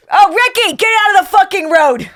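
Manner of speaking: mock Boston mother shouting at a toddler; Boston mom yelling. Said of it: "That's Boston".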